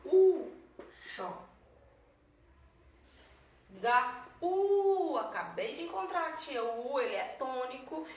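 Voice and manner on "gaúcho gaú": "Gaúcho" broken up, loud high stretched "ú"; slow, emphatic syllable-split "Gaúcho". Shown as a demonstration. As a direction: split "Gaúcho" with long gaps, punching the "ú". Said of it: "Tia, separa aí".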